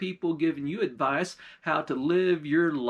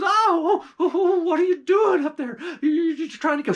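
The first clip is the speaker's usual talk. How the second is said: high, loud wailing cries of pain; a witch howling and sobbing in a churn; pained crying out; 13 semitones higher, 6 dB louder than usual. Acted. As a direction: shriek as the scalding water hits her. Witch scalded by boiling water.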